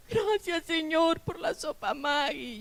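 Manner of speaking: put-on high, soft, tearful child's prayer; a retold tearful little grace; imitating crying children. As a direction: mimic the kids praying through tears.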